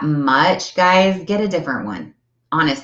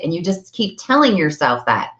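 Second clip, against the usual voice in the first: normal talking voice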